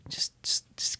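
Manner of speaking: whispered, soothing imitation; hushed calming. Mediator hushing an upset husband, calming whisper. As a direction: whisper it to settle someone down.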